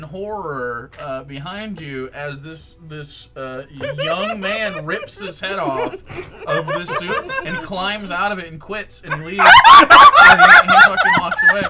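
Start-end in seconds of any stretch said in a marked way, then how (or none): 4.0-11.6 raised, loud voice through laughter; laughing narration. Real, not acted. Game master narrating the mascot quitting, laughing. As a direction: tell it while cracking up.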